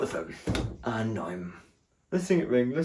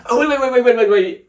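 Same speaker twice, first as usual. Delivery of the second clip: much higher, louder hurried calling; 11 semitones higher, 8 dB louder, faster than usual. Retold or imitated. Staff calling the customer back, loud high calling. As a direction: call someone back before they leave.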